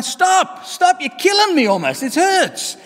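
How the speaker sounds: high pained cries of stop, slow and loud; cup crying out. Acted. Clay cup begging the potter to stop beating it, pained high cries. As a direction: wail it like something being beaten. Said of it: "I'm crying".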